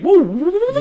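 high rising woo woo sound effect; mock chase whoops. Friend imitating a video's chase sounds, high whooping. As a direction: whoop like a cartoon chase siren.

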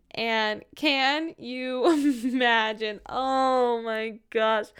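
slow, laughing, amazed; laughing disbelief. Real, not acted. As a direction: drawl it out in disbelief.

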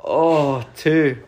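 loud groaning disappointment; disappointed groan. Real, not acted. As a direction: groan at losing narrowly. Just lost the quiz by two.

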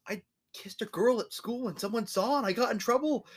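put-on high-pitched child's confession; confessing kid. Kid confessing trouble at school to a parent, high acted voice. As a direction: own up in a high little kid voice.

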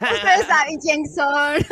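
playful echo. Real, not acted. Echoing friend's line.

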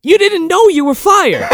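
high, laughing outburst; laughing disbelief. Retold or imitated. Mocking Carter's firing, laughing high. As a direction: crack up as you say it. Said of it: "I'm like".

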